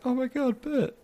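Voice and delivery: high, soft mock gasp of recognition; imagined amazed reunion. Returning film character greeted by an old friend, amazed. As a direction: squeak it softly as if seeing an old friend. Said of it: "it was like".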